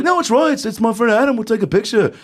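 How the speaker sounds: high, put-on British star voice; high British impersonation. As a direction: imitate a British musician, pitched very high. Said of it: "[name]'s like".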